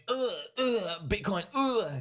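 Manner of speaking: high groaning imitation of a gloomy streamer; mock groaning streamer. Imitating Richard Hart on stream, high groaning mockery. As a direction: groan it out like a despairing bear. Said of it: "remember him talking like that".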